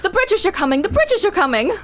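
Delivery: high-pitched British accent. Shown as a demonstration. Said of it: "you can do a British accent".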